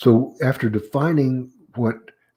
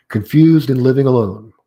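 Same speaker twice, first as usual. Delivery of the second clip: "Confused and living alone," raised and loud, then a half-second stop; comma pause; 3 semitones higher, 10 dB louder than usual. Shown as a demonstration. Lecturer reading to show comma pauses, "alone" held open before a pause. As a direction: hold a short pause after ALONE. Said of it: "I gave that half sentence pause".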